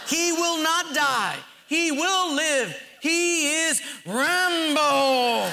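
high, slow, building proclamation; mock preaching. Husband proclaims Rambo's survival to wife, mock-triumphant. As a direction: draw it out to a grand climax.

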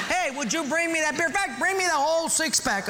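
preacher hams up a beer-belly husband; mock yelling husband. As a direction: holler from the armchair. Husband voiced in joke story.

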